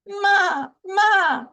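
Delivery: high, loud lamb cry; pleading high lamb voice; lamb calling. A narrator's reading. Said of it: "said the lamb".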